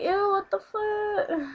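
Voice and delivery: high, drawn-out disgusted whine; retold disgust. Student handed nasty old candy, high drawn-out retold disgust. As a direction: act out being grossed out, slow and high-pitched.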